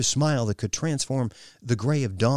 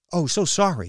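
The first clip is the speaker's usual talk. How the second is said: raised, louder, rushed flustered apology; flustered stammer; 8 semitones higher, 6 dB louder than usual. A narrator's reading. Kylie caught in the wrong doorway, flustered stammer. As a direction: blurt it out, caught off guard. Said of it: "she stammered".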